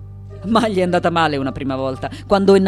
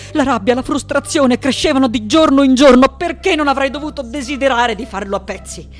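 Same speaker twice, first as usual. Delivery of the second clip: raised, pressured outburst easing at the end; mounting rage; 6 semitones higher, 5 dB louder than usual. Acted. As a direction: let pent-up frustration burst out. Recalls rage growing day by day. (rabbia che esplode)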